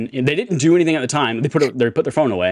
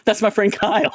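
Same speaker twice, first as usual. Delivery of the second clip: excited, higher voice pointing at the screen; pointing out a friend; 9 semitones higher than usual. Retold or imitated. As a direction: proudly point him out to a stranger. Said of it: "turn to whoever's next to me and go".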